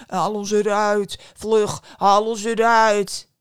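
loud, slow, drawn-out bread voice; calling loaves. Acted. Bread in the oven calls the girl, slow loud calling. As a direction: bellow it slowly from inside the oven. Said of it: "kwamen de stemmen uit de oven".

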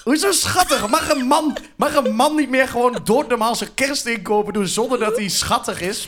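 high-pitched indignant protest; mock indignation. Real, not acted. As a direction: object as if insulted at being called cute. Mock indignation at being called cute.

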